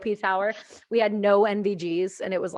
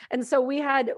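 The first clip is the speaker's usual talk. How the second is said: ordinary delivery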